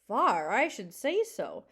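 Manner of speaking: put-on old gull. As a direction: stretch it out like a wise old gull.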